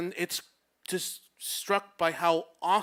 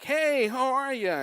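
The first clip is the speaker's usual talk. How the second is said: higher, drawn-out happy hello; cheery phone greeting; 9 semitones higher, 4 dB louder, slower than usual. Retold or imitated. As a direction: greet a new crush on the phone, delighted. Happy phone greeting in retold call.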